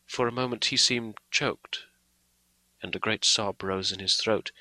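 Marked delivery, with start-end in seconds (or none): none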